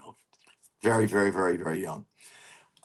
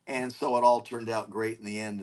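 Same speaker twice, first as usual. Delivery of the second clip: usual speaking voice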